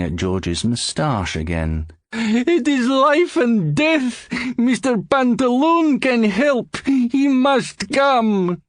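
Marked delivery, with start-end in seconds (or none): 2.1-8.6 put-on much higher, very slow, accented begging; desperate plea. George begs the doorman to fetch Pantaloon, slow and desperate. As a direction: drag each word out in despair.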